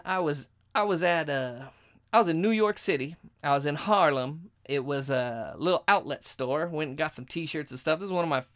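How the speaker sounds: ordinary talk, nothing marked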